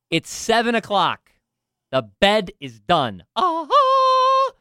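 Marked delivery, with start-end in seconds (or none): 3.4-4.5 high, loud victorious ah-ha; cartoon victory cry; triumphant ah-ha. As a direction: crow with triumph like a sound effect. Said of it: "you could have done that sound effect".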